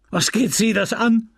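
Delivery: furious cry. A narrator's reading. Widower shouting at the apothecary over the cost. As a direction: lash out at him. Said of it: "schrie Charles".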